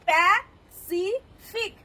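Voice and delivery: "Pacific" slowly in three parts, প্যা, সি, ফিক; split into syllables. Shown as a demonstration. Teacher showing three syllables, "Pacific" broken up slowly. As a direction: pause between each syllable of "Pacific". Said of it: "তিনটে syllable".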